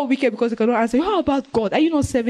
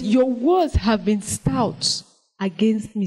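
slow solemn reading; much slower than usual. Shown as a demonstration. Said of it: "It says".

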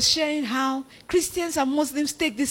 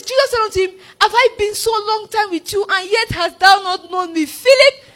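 high-pitched preaching read, building to the name; forceful scripture reading; 7 semitones higher, 5 dB louder than usual. Acted. Preacher voicing Jesus' question to Philip, forceful. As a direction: press the question home, louder on Philip.

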